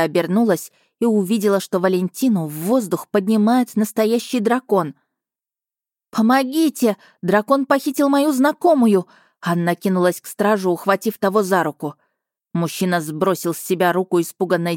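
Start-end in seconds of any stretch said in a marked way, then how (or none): 6.1-9.1 cry for help. Acted. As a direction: shout for help, panicked. Calling for help.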